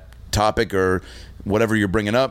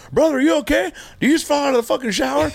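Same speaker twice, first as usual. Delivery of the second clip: much higher, fast, startled roommate; alarmed buddy; faster than usual. Retold or imitated. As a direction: blurt it out in disbelief. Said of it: "And I hear him go".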